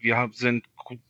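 usual speaking voice

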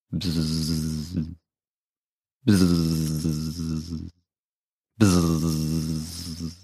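soft, low buzzing hum; quiet droning bzzz of an insect; mosquito buzz. Acted. Narrator imitates mosquito buzz.

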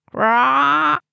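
high, loud parrot squawk; a shrill bird cry imitation. A narrator's reading. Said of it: "machte der Papagei".

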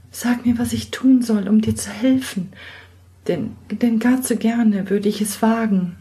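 put-on high girlish voice, stammering in alarm; she's caught out and bristles back; young girl, flustered and defiant; startled young girl. Girl offers to help.

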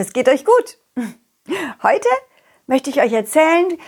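ordinary delivery, nothing marked

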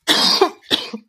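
a dry cough; coughing fit. Real, not acted. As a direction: clear the throat with a cough. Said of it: "einen Frosch im Hals".

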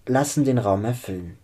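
ordinary delivery

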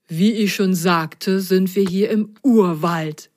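usual speaking voice

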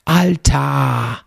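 "Alter" said like "Altar" without the R; "Alter" with a loud, long open final A; drawn-out Alter. Shown as a demonstration. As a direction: stretch "Alter" into a long "al-TAA". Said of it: "also Altar ohne R".

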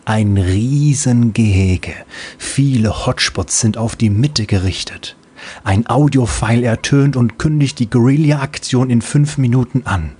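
ordinary delivery

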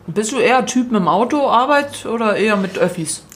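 talking while chewing; eating cereal. Real, not acted. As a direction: mouth full of food. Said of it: "ich esse grad nebenher".

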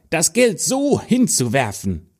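put-on a sneering older sister calls out; jealous stepsister. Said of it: "rief die Stiefschwester".

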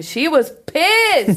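slow, punchy English gossip line; slow, relishing mock outrage. Shown as a demonstration. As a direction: drag it out like juicy gossip. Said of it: "man kann aber auch sagen".

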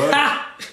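a quick real laugh at the memory; short laugh. Real, not acted. Laughing at his own tip story to the friend. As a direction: burst out laughing briefly.

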